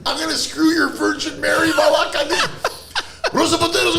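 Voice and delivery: high-pitched mock cursing tirade; hammed-up husband's rant. Furious husband cursing in the kitchen, high acted rant. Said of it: "he's just going".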